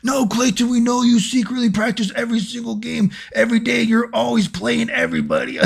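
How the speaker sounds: high, whiny sneering hater voice; mocking the haters. Mimicking video-game haters in comments, high whiny sneer. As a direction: parody an accusing online hater. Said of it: "the haters that watch my videos".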